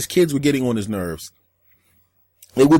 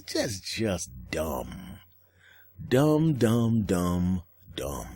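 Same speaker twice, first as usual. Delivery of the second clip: fading dumb; 6 semitones lower, 5 dB quieter, much slower than usual. Acted.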